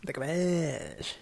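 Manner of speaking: slow, drawn-out promo announcer. Acted. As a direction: say it like a TV promo tag. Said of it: "about to come in with the commish".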